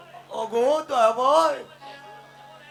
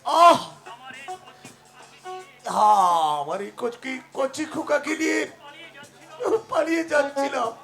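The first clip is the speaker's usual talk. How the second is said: dying groans. Acted. Dying man groans, struck by lightning.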